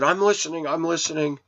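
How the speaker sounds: half-asleep man caught dozing insists he's listening; startled protest. Retold or imitated. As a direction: snap back awake, swearing you're listening. Jolted awake while dozing off.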